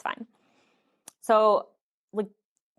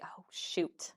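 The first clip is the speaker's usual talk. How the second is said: breathy whisper, much softer; whispered thought; 14 dB quieter than usual. Retold or imitated. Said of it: "I thought in my head".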